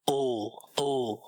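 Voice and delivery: ओ with fully rounded lips; a rounded German o in ओ. Shown as a demonstration. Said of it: "lips को round करो".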